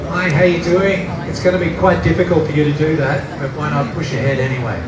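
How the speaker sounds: ordinary delivery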